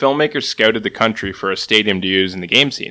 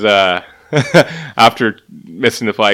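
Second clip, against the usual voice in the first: normal talking voice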